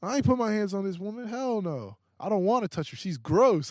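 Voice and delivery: high, loud, indignant protest; angry denial. Retold or imitated. Denying the accusation to the cops, high, loud and outraged. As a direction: insist you never touched her, outraged. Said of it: "I'm so mad".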